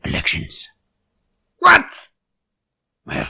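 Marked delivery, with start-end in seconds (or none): 1.6-2.1 a sudden high, loud yelp of disbelief; startled what; incredulous what. Acted. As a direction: blurt it out in shock. Reader reacting to the book.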